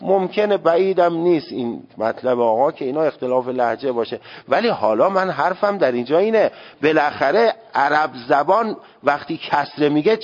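ordinary talk

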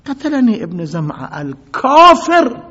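slow pained loud outcry; crying out. Acted. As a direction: cry out that you've been killed. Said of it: "ابن مسعود داد زد".

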